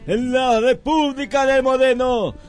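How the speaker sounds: radio sports announcer. Acted. Parody radio host intro.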